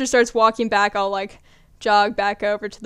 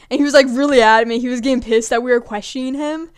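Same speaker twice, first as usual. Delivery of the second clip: normal talking voice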